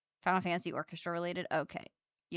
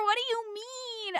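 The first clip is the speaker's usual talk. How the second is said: whiny disbelief. Real, not acted. Outraged whine.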